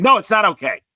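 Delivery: high, loud mock-indignant retort; irate refusal. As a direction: imitate an irate stranger shouting someone down. Retelling a stranger's angry refusal.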